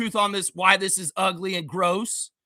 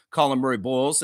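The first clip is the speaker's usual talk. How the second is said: normal talking voice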